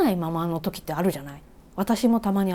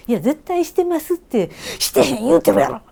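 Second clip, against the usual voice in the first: loud, higher Kansai-dialect bickering; heated Kansai dialect; 7 semitones higher, 6 dB louder than usual. Retold or imitated. [name] denying her irritation to a junior, loud Kansai dialect. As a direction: escalate the spat in Kansai dialect. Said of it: "めっちゃ関西弁になってしまった".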